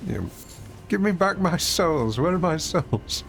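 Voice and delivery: mock-plaintive demand for lost souls; put-on voice. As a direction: drag the words, mock forlorn. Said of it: "Did you not know I could speak like that".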